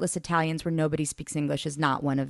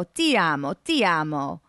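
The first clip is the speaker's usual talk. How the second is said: put-on Italian accent. A narrator's reading. Marco voiced.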